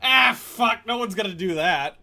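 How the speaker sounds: acting out past pessimism, hammed-up; resigned groan. Husband retelling his doubt about the goal, mock-despairing outburst. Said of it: "I was like".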